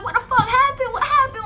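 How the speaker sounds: high, loud alarmed mother; alarmed mom. Retold or imitated. Mom asking her daughter what happened, high and loud. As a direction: voice mom demanding answers, up high.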